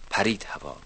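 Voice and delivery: plain reading voice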